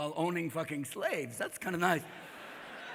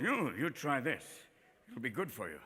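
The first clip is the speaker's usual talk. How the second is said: Native offering food; 7 semitones lower, 9 dB quieter than usual. Acted. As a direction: offer it quietly, in a low voice. Indian quietly offering food to settlers.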